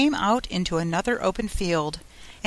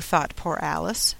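plain reading voice

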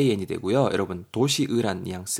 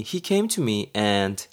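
slow example read; much slower than usual. Shown as a demonstration.

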